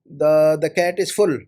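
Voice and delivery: ordinary delivery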